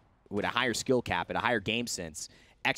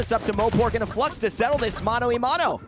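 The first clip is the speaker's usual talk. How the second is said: loud, high hype play-by-play; esports caster; 12 semitones higher, 6 dB louder than usual. Real, not acted. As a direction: call the play at full volume. Live play-by-play fight.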